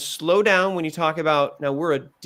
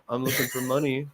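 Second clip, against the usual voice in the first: softer, low-energy mock ask; limp mock ask; 4 semitones lower, 5 dB quieter than usual. Shown as a demonstration. Coach mocking a pitcher asking for money without energy. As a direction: mumble it with no energy. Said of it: "somebody who's like".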